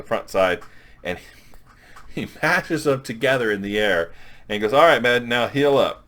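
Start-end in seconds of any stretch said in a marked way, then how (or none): 4.7-6.0 mock hearty shout. Forge mashing Wolverine's halves together.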